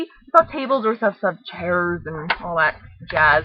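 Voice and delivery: plain reading voice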